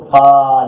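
قال with both vowels held long and loud; loud, lengthened قال for the class; drawn-out vowel. Shown as a demonstration. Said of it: "هل حصل تغيير؟".

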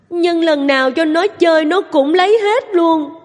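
put-on high, tearful child's voice; crying little girl. A narrator's reading. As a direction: plead like a hurt little girl. (giọng bé gái nức nở, cao)

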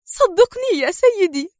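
high, urgent plea; pleading cry. Acted. Sonia begging the director, high and urgent. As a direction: beg him to believe you. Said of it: "هتفت سونيا".

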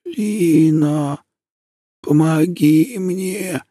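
put-on thin, high, very slow old voice; a voice about to break off; frail old woman voice. A narrator's reading. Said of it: "Голос бабушки в темноте казался тонкой-тонкой линией".

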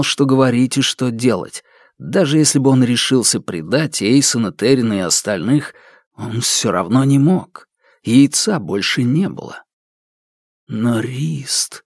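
usual speaking voice

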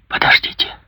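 whispered with a lump in throat; choked whisper. A narrator's reading. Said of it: "сделала над собой усилие".

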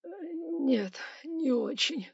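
high, tearful mumble; whimpering high little answer; timid babble. A narrator's reading. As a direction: falter meekly in fear. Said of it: "пролепетал ростовщик".

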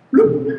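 high mouth-made splash; plop sound. Retold or imitated. Preacher imitating the iron splashing into the Jordan. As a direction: pop out a high plop noise. Hatchet head falls into water.

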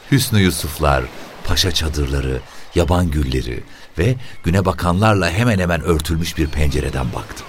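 ordinary delivery

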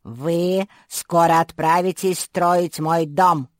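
loud, slow proclamation; shouting creature. A narrator's reading. Strange creature ordering captives to build, slow loud shout. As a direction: announce it slowly and forcefully. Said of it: "закричал не пойми кто".

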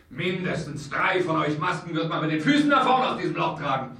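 laut und höher, kampfbereit. Acted. Marquis droht mit der Pistole. (Marquis droht den Verschwörern laut)

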